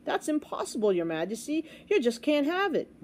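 put-on high-pitched protest of a page boy; gasping disbelief. A narrator's reading. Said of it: "Bartholomew gasped".

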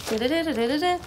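rapid vocalized "da-da" patter; page-flipping noise. Retold or imitated. Daughter flipping through a Bible, imitated page-flipping noise.

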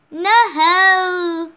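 loud, high, hammed-up cry; dramatic outcry. Player lamenting the dead wombat, loud dramatic outcry. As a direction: belt out a mock cry of dismay.

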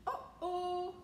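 high, loud sing-out of trouble; alarmed uh-oh. A narrator's reading. Narrator announcing trouble for the bus, high loud uh-oh. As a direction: call out a storybook uh-oh, drawn out. Trouble interrupts the happy trip.

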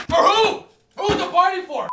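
high, loud, demanding question; insistent shout. Acted. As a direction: demand an answer loudly. Demanding an answer.

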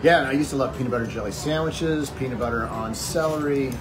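ordinary talk

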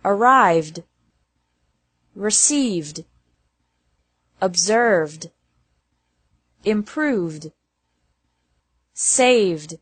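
"arrived", "received", "saved" ending in a joined V-D; careful word-list demo, "saved" closing straight from V to D. Shown as a demonstration. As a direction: end "observed" and "improved" on D, no extra syllable. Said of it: "pronounced like D after verbs".

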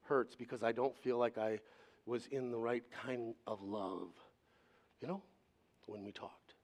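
low, soft retold apology; heartfelt apology. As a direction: recount a sincere apology quietly, with halting pauses. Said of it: "No, I said, ... my heart hurts".

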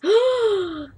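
high drawn-out gasp; mock gasp. Player hears of rival stars to compete with, mock gasp. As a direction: gasp high, as at big news. Reacting to other porn stars.

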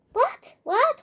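very high, short exclamations; startled disbelief. Real, not acted. Player baffled by the game, startled disbelief. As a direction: squeak it out, incredulous.

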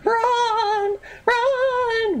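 very high, drawn-out squeal; panicked cry. Pretend. Player fleeing the boss, high panicked cry. Urging escape from boss attack.

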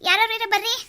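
high, loud call into the house; panicked cry for help. Acted. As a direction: shout for anyone nearby.

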